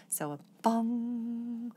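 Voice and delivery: slow, ringing bong like a bell. Shown as a demonstration. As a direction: let the bong ring out slowly. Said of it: "achieve this bell tone sound".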